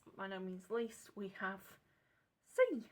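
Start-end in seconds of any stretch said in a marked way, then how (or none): 2.5-2.8 high loud announcement of the name; excited reveal. Real, not acted. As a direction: unveil it like a grand finale. Announcing last paint with flourish.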